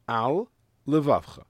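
AL, then a clear pause before LEVAVCHA; separated words. Shown as a demonstration. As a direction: stop briefly between "al" and "levavcha". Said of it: "stop for a second to separate the words".

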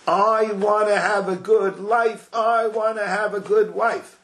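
a hint of a selfish groom. Selfish groom declaring what he wants, mock earnest.

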